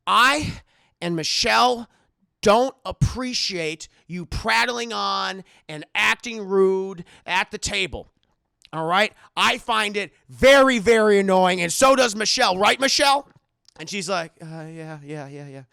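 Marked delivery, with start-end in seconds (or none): 0.1-13.3 slow, loud, emphatic scolding; acted seething reprimand; stern, angry Howard. 14.4-15.6 soft, hesitant agreement; acted nervous murmur; meek Michelle.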